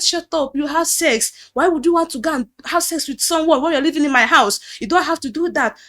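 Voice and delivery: imitating his furious scolding; mock angry shout at the girl; angry pastor. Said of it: "He said".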